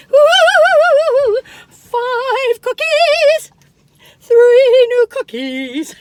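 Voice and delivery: high, loud, slow and stretched; hammed-up excitement; excited announcement. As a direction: announce it like a game-show host.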